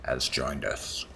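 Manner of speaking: slow, deep, ominous herald; spooky voice. Acted. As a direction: intone it like a dark announcer from the shadows. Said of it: "Trying to make you s-, sound spooky".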